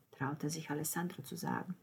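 ordinary delivery, nothing marked

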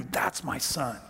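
a father knowing his son, said low and soft; quiet awe. Real, not acted. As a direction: let it land softly as a realization. Father recognizes son in that moment.

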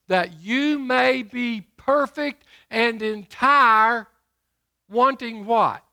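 loud and slow, stretching each word; slow booming sermon read; slow preaching. Acted. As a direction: draw the verse out from the pulpit.

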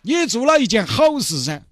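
high, sing-song comic voices haggling over the dolphin; pleading buyer and gruff vendor. Acted. Mr Chen begging the fishmonger to free the dolphin, high hammed-up pleading.

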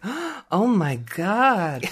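slow, high breathless oh my God; awestruck gasp. Retold or imitated. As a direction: gasp it out in awe. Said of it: "we were both just like".

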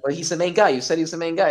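high, loud insistent protest; indignant protest. Retold or imitated. Retold protest to friend.

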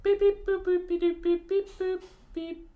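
squeaky beep boop noises; high beeping computer noises. Pretend. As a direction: chirp like a robot.

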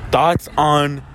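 ordinary delivery

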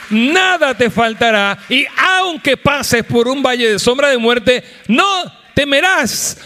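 loud, high, slow fiery proclamation; preaching at full force. Acted. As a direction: thunder the promise to the congregation. (predicando con toda fuerza)

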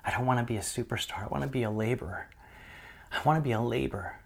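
soft, whispered, tearful prayer; hushed weeping prayer to God. Retold or imitated. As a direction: whisper it like a broken confession. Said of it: "started weeping and saying".

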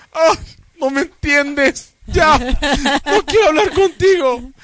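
high-pitched, drawn-out exasperated whine; mock upset girlfriend. Man mimicking his girlfriend in an argument, whiny mock upset.

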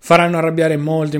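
ordinary talk, nothing marked

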